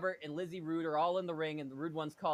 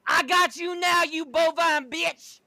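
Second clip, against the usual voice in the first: shouting; 11 semitones higher, 9 dB louder, slower than usual. Acted. Noble spots something offscreen, loud shout. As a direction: holler it while chasing off. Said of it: "Noble shouts".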